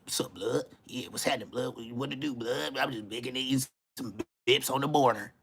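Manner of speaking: soft, low mimicry of slang talk; mock slang chatter. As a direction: toss it off under the breath, mockingly. Mimics others overusing "blood.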